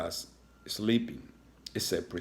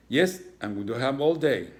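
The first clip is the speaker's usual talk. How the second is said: impatient; 5 semitones higher, 6 dB louder than usual. Acted. Impatient principal.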